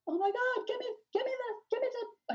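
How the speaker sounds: high-pitched frantic pleading; freaking out. Retold or imitated. Mother retelling a scramble for seizure medicine, frantic. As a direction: re-enact a panicked demand. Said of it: "I'm, like, freaking out".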